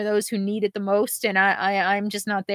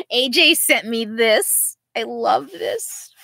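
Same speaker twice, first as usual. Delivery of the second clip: tender laugh; 3 semitones higher, slower than usual. Real, not acted. Shows a beloved photo.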